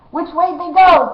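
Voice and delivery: high, loud searching call; cried out. A narrator's reading. Cutwater cries out after the fleeing boys, high and loud. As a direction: shout it into the dark. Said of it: "cried out Cutwater".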